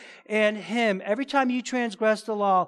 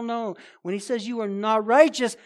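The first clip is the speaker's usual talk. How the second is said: usual speaking voice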